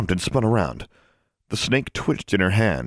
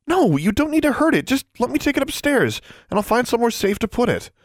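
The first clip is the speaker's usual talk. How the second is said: put-on high, urgent girl's protest; worried, high-voiced objection; anxious protest. A narrator's reading. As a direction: plead the case in a raised pitch. Girl protests to protect the snake.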